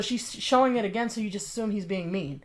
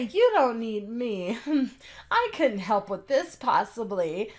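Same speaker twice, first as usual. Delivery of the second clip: slow, whiny put-on pity voice with a laugh; mock her fishing for sympathy; mocking self-pity; slower than usual. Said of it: "When people say".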